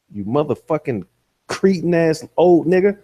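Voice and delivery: ordinary delivery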